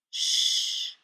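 long voiceless "shh"; hissed shush. A narrator's reading. Ray hushing Fraser behind a finger, hissed shush. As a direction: hush him with a drawn-out hiss. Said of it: "I hiss behind my finger".

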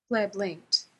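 ordinary delivery, nothing marked

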